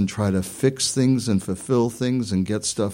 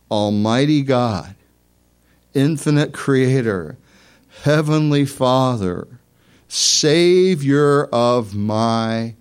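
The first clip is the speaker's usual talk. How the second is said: loud, raised, slow declaration in prayer; fervent prayer; 5 semitones higher, 6 dB louder, slower than usual. Real, not acted. Preacher prays for the congregation before communion, fervent and loud. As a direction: proclaim it to God, phrase by phrase.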